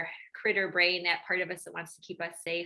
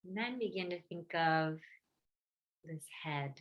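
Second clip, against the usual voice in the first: guided meditation; 2 semitones lower, 6 dB quieter than usual. Real, not acted. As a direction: gently guide listeners inward, leaving space between phrases.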